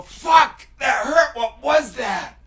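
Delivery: high, loud cry of pain; startled pained yell; pained shout. Retold or imitated. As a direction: yell it as if it just hit. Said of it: "and you go".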